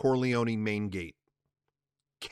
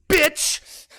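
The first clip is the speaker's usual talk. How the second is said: much higher, loud spat curse; furious curse; 9 dB louder than usual. Acted. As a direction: spit it at the mistress. Connie after hanging up.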